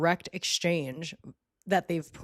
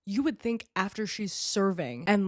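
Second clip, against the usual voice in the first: ordinary delivery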